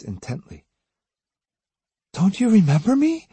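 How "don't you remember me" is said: high-pitched disbelieving question; astonished female character voice, pitched up; incredulous. A narrator's reading. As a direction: ask in pained disbelief, voice lifted high. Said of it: "she said incredulously".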